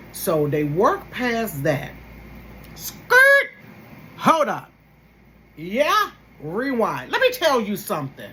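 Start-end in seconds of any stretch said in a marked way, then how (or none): 2.7-3.5 loud high record-scratch noise; mock brakes screech; skirt sound effect. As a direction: screech to a halt with your voice. Record-scratch stop to rewind.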